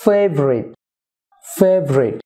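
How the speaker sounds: "ফেভারিট" as fev-rit, each falling; two-syllable favourite. Shown as a demonstration. Teacher drills for viewers, "ফেভারিট" as fev-rit. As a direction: repeat "ফেভারিট" as FAYV-rit with a pause between. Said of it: "two syllable হবে".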